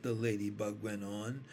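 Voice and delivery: plain reading voice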